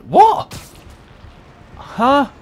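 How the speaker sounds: high, loud what; outraged disbelief; shocked. Real, not acted. Shocked at count.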